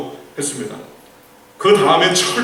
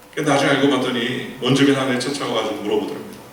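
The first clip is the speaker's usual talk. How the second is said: ordinary talk, nothing marked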